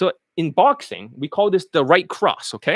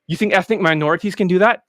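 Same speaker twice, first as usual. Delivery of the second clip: ordinary talk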